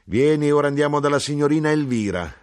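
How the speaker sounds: decisive mother. Acted. Mother to son, loud and decisive. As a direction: sweep him along eagerly.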